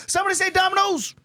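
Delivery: very high fast squeal; squealed ad line. Pretend. Replaying the Domino's slogan as a high squeal. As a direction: squeal it like a mocking commercial.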